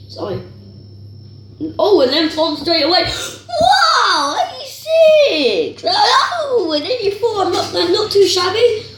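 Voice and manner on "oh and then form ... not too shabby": high, breathless laughing delight; excited laughing squeal. Real, not acted. Youtuber opening a pack, squealing with delight. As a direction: squeal with joy at the card. Surprised by great pack ratings.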